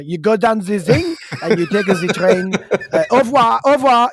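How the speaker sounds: hammed-up French accent, laughing through the directions. A dad pretending to be French for American tourists, mock French accent. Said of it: "I might have even used a French accent".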